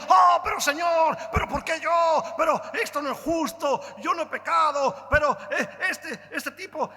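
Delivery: hammed-up outrage, pleading why me; indignant complaint. Preacher imitating how he'd complain to God, indignant shout. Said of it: "hubiera dicho".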